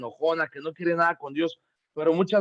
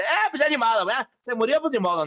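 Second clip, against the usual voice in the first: mock shrug in a high-pitched voice; high, careless "oh well"; dismissive attitude; 8 semitones higher, faster than usual. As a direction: imitate someone brushing off a death. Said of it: "Tampoco es tomar una actitud de".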